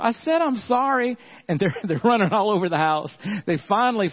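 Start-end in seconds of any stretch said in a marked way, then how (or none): 0.0-1.2 put-on high, exasperated little girl; high childish protest. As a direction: play the five-year-old sister, fed up. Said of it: "Layla's right behind him".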